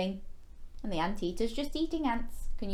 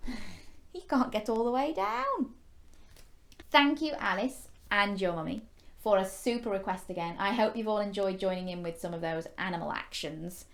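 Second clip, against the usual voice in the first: usual speaking voice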